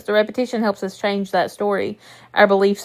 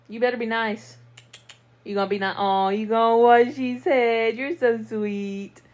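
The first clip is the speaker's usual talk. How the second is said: soft pet talk; 5 dB quieter than usual. Real, not acted. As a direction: gently coo at the cats. Talking softly to pets.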